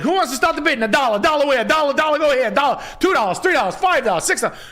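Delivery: high rapid auctioneer chant. Retold or imitated. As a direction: rattle off the bids like an auctioneer on a golf cart. Auctioneer rattling off bids.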